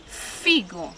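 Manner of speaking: "figo" opening on a long held f; stretched initial sound. Shown as a demonstration. Teacher repeating the matched word, "figo" with held f. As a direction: linger on the f that starts "figo". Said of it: "pelo mesmo som de".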